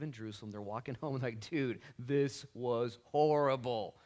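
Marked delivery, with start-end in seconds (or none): 1.5-4.0 drawn out very slowly, word by word; dragged-out mock misery; acted dismay. Disciples walking home after the worst weekend, drawn-out dismay.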